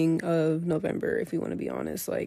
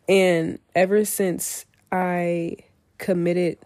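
normal talking voice

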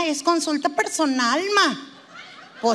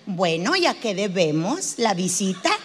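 low, measured therapist voice; low-pitched counsellor with a probing lilt; psychologist's opening question; 7 semitones lower than usual. Acted. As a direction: open the session in a low, composed register. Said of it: "luego, luego te preguntan".

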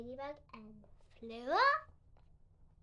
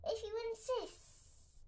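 lazy snake voice; 10 semitones higher, 3 dB quieter than usual. A narrator's reading. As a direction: purr it like a sly snake. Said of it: "said the snake".

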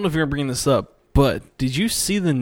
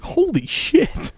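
shocked; 3 dB louder, much slower than usual. Retold or imitated. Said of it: "I was like".

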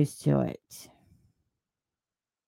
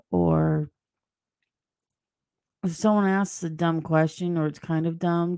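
ordinary talk

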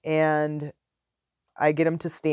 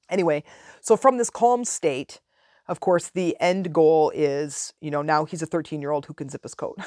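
ordinary delivery

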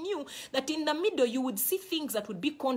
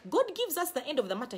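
ordinary talk, nothing marked

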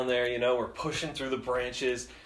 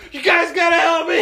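high, loud yelling plea; mock desperate shout; 10 dB louder than usual. Drunk friend rolling away yelling to his buddies, high loud shout. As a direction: holler for help from a runaway dumpster.

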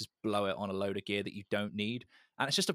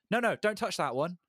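higher-pitched mock warning; protective warning; 7 semitones higher than usual. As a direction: warn them off a prized possession, voice raised in pitch. Acts out guitarist guarding his guitar.

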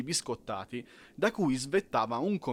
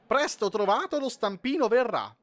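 much higher, screechy penguin voice; graceless screech; 8 semitones higher than usual. Acted. Penguin queen shrieks to the crowd, ungainly screech. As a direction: screech it out awkwardly. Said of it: "strillò sgraziatamente".

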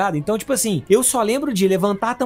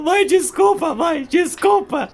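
pleading; 9 semitones higher, much slower than usual. Retold or imitated. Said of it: "eu falando".

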